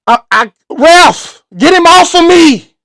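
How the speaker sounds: high, loud hammed-up yell for help; mock plea to the ref. Host pretends to be pinned by the story, loud mock yell. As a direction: scream at the ref to pull him off, joking.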